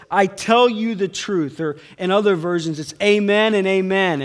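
preacher lands Jesus' words on the crowd; forceful preaching. Acted.

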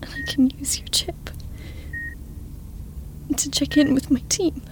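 unvoiced, quick whisper. Whispering a confession to the comatose woman. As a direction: hurry the guilty thought under breath.

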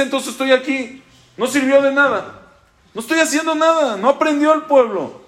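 high, strained voice of a man breaking down; high, pleading voice of a teacher who feels he has failed. Acted. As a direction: let the voice crack as Moshe gives up. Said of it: "Ahí es cuando Moshe se quiebra".

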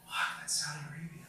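very soft, awestruck murmur; barely voiced, stunned reply; hushed awe. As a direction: breathe it out in quiet amazement. Own awed reply to friend.

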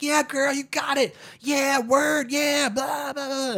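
hammed-up hollering like partiers in the lobby; imitating rowdy crowd. Comedian imitating crowd that wouldn't leave, high mock yelling. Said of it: "like, yelling, like".

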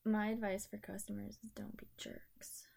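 whispered advice. Real, not acted. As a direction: whisper it conspiratorially.

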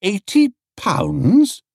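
"Eighty" drawn out high and slow, rising; shocked exclamation. A narrator's reading. Paddington hears the clamping fee, aghast. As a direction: gasp out "Eighty pounds" in disbelief. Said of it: "he exclaimed".

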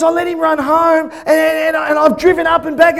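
high, loud, frantic; a mother explaining in a panic; imitating a high, loud, frantic report; panicked. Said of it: "She goes".

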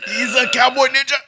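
hammed-up excited game pitch; mock excited pitch. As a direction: sell it like a crazed idea guy.

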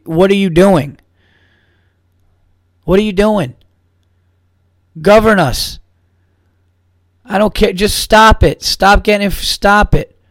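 loud fed-up rant at the president; exasperated rant. Real, not acted. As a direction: yell it like you're fed up with him. Ranting at the president.

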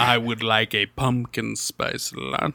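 low, slow, proper gentleman; posh formal voice. Retold or imitated. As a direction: put on a slow, refined voice. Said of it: "and you say".

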